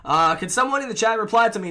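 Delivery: ordinary talk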